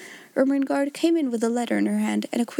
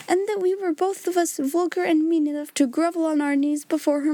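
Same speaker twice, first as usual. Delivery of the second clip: higher-pitched, impassioned accusation; hysterical outburst; 6 semitones higher than usual. A narrator's reading. Miss Amelia tells off her sister, hysterical and high. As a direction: pour it out in a high, agitated voice. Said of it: "had made her hysterical".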